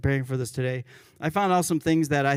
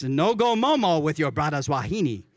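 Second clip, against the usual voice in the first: "No go momo with your brother's wahine" in a louder, amused Hawaiian Pidgin lilt; 3 semitones higher, 4 dB louder than usual. Retold or imitated. Pastor reading the funny commandment to the congregation, Hawaiian Pidgin lilt. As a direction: relish the Pidgin words like a joke. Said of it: "This one really made me laugh".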